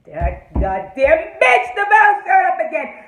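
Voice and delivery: slow, loud start rising to a shout; high, loud indignation; outraged rant. Real, not acted. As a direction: explode at the villain's return. Furious rant at the villain.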